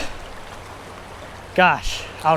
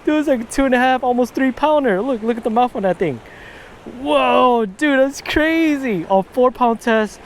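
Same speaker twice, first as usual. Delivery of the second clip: higher, slowed, awed delight; thrilled; 6 semitones higher than usual. Real, not acted. Angler showing off his big largemouth, thrilled and high. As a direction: marvel at the fish, voice up high.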